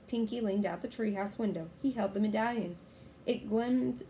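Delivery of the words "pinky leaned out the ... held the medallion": usual speaking voice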